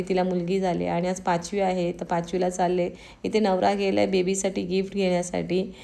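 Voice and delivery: ordinary delivery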